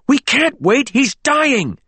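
very high, panicked cry; desperate plea. A narrator's reading. James begs to save dying Richard, panicked. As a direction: plead for immediate rescue.

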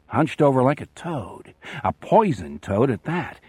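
ordinary delivery

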